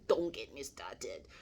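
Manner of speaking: slow, husky half-whisper; mock warning. Speaker jokes to listeners about yelling at children, mock warning. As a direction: repeat it slowly as a joking warning, voice nearly gone. Joking repeat between laughs.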